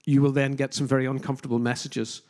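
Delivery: normal talking voice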